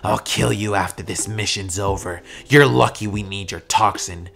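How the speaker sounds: low menacing villain voice; Shigaraki. Acted. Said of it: "Shigaraki would move his hand back and just be like".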